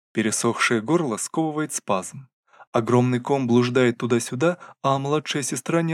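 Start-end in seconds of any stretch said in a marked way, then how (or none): none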